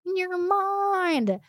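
MIND stretched long on a high held pitch; sing-song high, very slow "in your mind"; drawn-out emphasis. Acted. Teasing repeat for emphasis.

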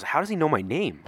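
high-pitched bewildered question; baffled surprise. Retold or imitated. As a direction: retell a startled thought, voice lifting. Retelling his startled thought mid-fight.

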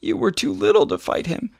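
lightly voiced sobbing Billy; mild sobbing boy. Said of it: "Billy sobbed".

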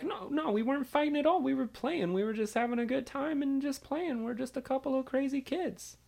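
put-on high, soft innocent child voice; pleading little kid. Kid explains the scuffle to monitor, high innocent pleading. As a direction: talk your way out in a high, small voice.

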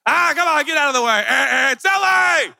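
hammed-up road rage shout; mock road rage. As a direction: bark at the car ahead. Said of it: "horn honker".

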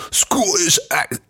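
hammed-up Scottish burr; thick Scottish accent. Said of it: "the thick Scottish".

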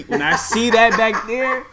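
loud, slow and worked up; excited. Real, not acted. Excited rising build.

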